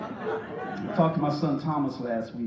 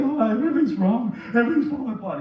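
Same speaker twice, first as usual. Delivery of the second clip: mildly hammed-up distraught mother; mock crying; 6 semitones higher, 4 dB louder than usual. Distraught mother to her preacher, mock crying. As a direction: imitate an upset woman's wail, lightly. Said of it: "She was crying so vehemently".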